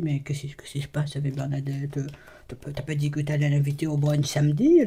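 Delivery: low, soft family voice; family member asking. Retold or imitated. Family asking about Bernadette, low and soft. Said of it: "quand ma famille me dit".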